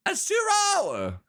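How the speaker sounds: high drawn-out call. Real, not acted. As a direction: call across the map. Calling for character.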